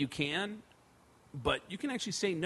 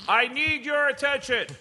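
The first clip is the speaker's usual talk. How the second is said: crowd call; 9 semitones higher, 6 dB louder than usual. Retold or imitated.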